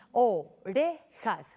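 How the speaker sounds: "Orejas" slowly, in three detached beats; split into syllables. Shown as a demonstration. Teacher confirming three syllables, "Orejas" split slowly. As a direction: break "Orejas" apart syllable by syllable. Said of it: "Tres sílabas".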